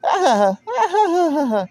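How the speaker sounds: high, airy laugh mimicking a game character; imitating a female game laugh. Retelling a game laugh heard in her head, high mock laugh. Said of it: "it was the female laugh".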